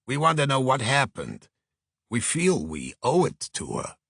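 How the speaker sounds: earnest Brooklyn agent; Brooklyn accent. Acted. Agent asking detective to investigate client's death, earnest Brooklyn accent. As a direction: press your appeal earnestly in Brooklyn tones. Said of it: "a voice with a strong Brooklyn accent".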